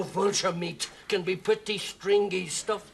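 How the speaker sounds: normal talking voice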